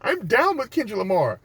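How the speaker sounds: very high airy fake-friendly voice. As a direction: gush insincerely to seem cool. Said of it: "smile in your face".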